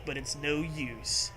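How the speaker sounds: normal talking voice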